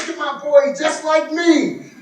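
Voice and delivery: high, proud brag; boasting dad. Retold or imitated. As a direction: show off the son.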